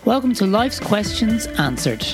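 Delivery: ordinary talk, nothing marked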